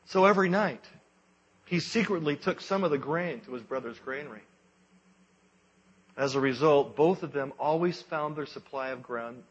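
solemn storyteller, voice lifted; grave ancient myth telling. A narrator's reading. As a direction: tell it like an old legend.

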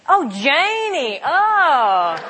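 a Tennessee local finally getting "Janie", drawled; a Southern listener's drawled "Oh, Janie", then a pleased "Oh". Retold or imitated. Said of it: "Then they understood".

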